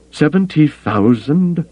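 aghast echo, high and drawn out; appalled, incredulous question. A narrator's reading. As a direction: echo the figure in slow, high-pitched disbelief.

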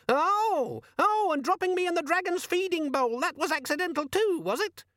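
very high squeaky scoff; very high, squeaky sarcastic demon. A narrator's reading. Organizer demon mocking Carrot, squeaky and sarcastic. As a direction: sneer like an offended gadget.

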